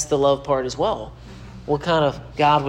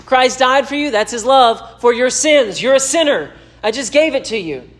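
higher, louder, driving sermon delivery; fervent preaching; 7 semitones higher, 3 dB louder than usual. Acted. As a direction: press it home to the listener like a preacher. Preaching at rising force.

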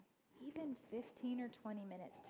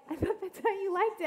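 much higher and louder, laughing through it; laughing tease; 9 semitones higher, 11 dB louder than usual. Retold or imitated. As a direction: tease her while laughing. Coworker teasing about eyeshadow.

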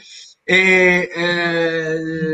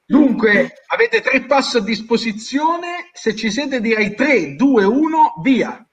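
showy game show host; 4 semitones higher than usual. Acted. Host launching the round countdown.

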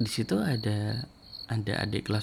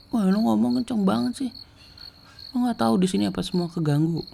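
high, raised voice in mock outrage; retold angry scolding; 12 semitones higher, 3 dB louder than usual. Diti scolds the loud seniors, retold angry outburst. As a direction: act out her high-pitched complaint. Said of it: "marah gitu".

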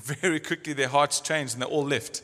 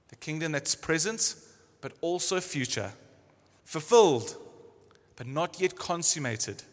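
normal talking voice

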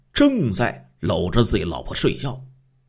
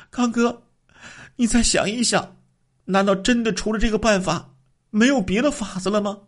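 high woman's voice, pleading; the wife asks her husband for another way, high and pleading; 11 semitones higher than usual. A narrator's reading. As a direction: beg him to reconsider in a high voice.

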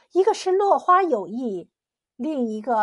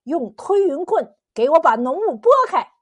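raised, forceful command; higher, louder, emphatic; commanding order; 4 semitones higher, 6 dB louder than usual. A narrator's reading. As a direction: order the servant to get it done. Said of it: "只得让推云童子下令".

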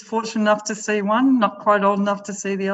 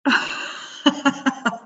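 laughing; 7 semitones higher than usual. Real, not acted. Modest laughing denial.

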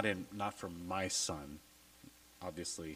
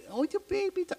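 very high, loud mock cooing to a baby; mock baby talk; 7 dB louder than usual. Mocking how people talk to a baby, baby talk. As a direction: put on exaggerated baby talk. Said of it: "I don't do the baby talk".

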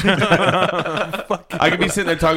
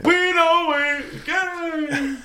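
high squeaky voice; 13 semitones higher, much slower than usual. Retold or imitated. Mocking the movie voice.

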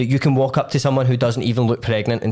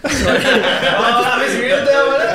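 laughing; 10 semitones higher, 5 dB louder, much slower than usual. Real, not acted. Laughs at the odd silence.

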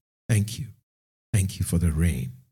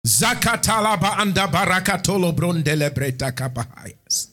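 speaking in tongues; 8 semitones higher than usual. Acted.

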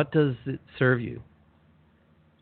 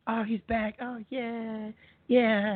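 much higher, mocking sheep voice; high, soft taunting voice of the flock; 12 semitones higher, 3 dB quieter, much slower than usual. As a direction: mimic the sheep sneering at the one who came back.

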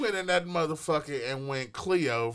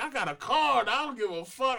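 higher, defiant; defiant boast; 8 semitones higher than usual. Retold or imitated. Sister flaunting her card, higher defiant boast. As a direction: flaunt it at the cops. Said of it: "She's like".

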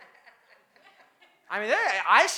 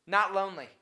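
ordinary delivery

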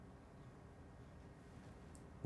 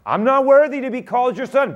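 sincere confession; 10 semitones higher, 7 dB louder than usual. Acted. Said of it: "he said".

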